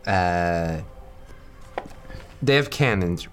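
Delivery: ordinary talk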